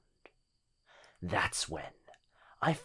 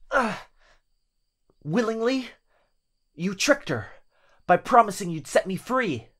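willingly shot back higher and louder in disbelief; outraged boyfriend; 13 semitones higher, 11 dB louder than usual. Acted. Boyfriend learning of the trick, outraged loud willingly. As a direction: accuse him, voice cracking high.

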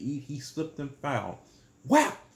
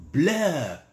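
disgusted blah; 4 semitones higher, 8 dB louder than usual. Acted. Beaver spits out leaves.

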